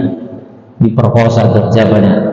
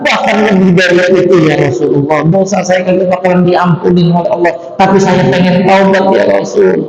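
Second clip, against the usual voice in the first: much higher, fast, anguished plea; desperate youth; 8 semitones higher than usual. Acted. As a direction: cry out wanting to repent. Youth pleads for repentance. (pemuda menangis ingin bertaubat, suara tinggi)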